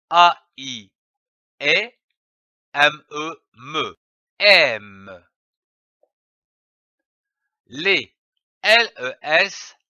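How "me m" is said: ordinary delivery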